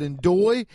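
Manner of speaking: "endoy" said with a d where the j of enjoy belongs; mispronounced enjoy. Shown as a demonstration. Host replays his own slip of enjoy, saying "endoy". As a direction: slur "endoy" as a botched enjoy. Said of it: "'cause I said".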